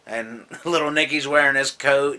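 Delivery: ordinary talk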